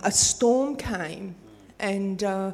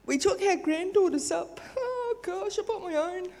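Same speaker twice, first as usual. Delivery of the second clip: laughing; 10 semitones higher than usual. Real, not acted. Laughing at mistake.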